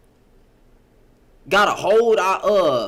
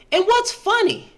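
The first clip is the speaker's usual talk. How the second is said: incredulous; 12 semitones higher than usual. Real, not acted. Reacting to laughter in chat.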